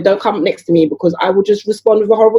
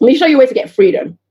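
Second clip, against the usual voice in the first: normal talking voice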